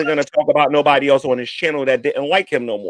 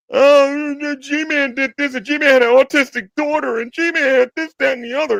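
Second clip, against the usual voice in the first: higher, slow sneering whine; mocking whiny voice; 8 semitones higher, slower than usual. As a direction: mimic the haters like a petty gossip. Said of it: "they wanna go".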